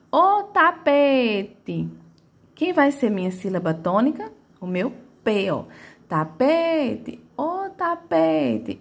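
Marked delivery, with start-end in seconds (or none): 0.1-1.9 high, very slow call of "ô tapete", PE held long; calling, stretched PE. Shown as a demonstration. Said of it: "É a minha sílaba que mais se arrasta". 6.1-7.2 higher-pitched Tapete with the PE held long; stress on PE. Shown as a demonstration. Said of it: "É a minha sílaba que mais se arrasta". 7.3-8.8 high, slow call of "ô tapete" with a long PE; calling, stretched PE. Shown as a demonstration. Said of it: "É a minha sílaba que mais se arrasta".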